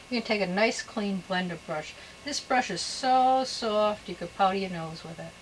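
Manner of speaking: ordinary talk, nothing marked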